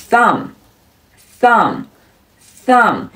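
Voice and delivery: "thumb" ending on M, no B; slow, separate "thumb", closing on the M; silent final B. Shown as a demonstration. Teacher drilling a finger name, "thumb" with silent B. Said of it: "注意末尾的B是不发音的".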